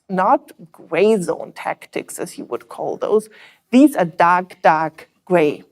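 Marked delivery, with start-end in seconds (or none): none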